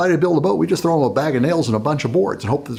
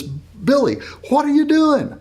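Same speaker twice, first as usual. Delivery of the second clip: big brother teasing; 12 semitones higher, slower than usual. Acted. Big brother catching Billy with cookies, mocking high call. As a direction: tease the little brother over your shoulder. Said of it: "looks over his shoulder and says".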